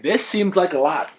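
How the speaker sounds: high, loud bewildered outburst; mock dismay. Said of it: "And you're like".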